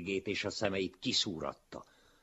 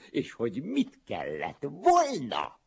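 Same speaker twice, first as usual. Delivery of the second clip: high, loud jeer on the last word; emphatic mocking exclamation; 10 semitones higher, 9 dB louder than usual. A narrator's reading. Smug pupil silently mocking the one answering, loud and mocking.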